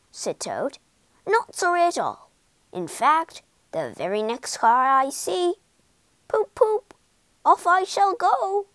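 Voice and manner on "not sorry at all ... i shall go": high, excited boast, climbing to a hoot; gleeful defiance. A narrator's reading. Toad tells Badger he will drive off, gleefully defiant. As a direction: get carried away, hooting like a horn.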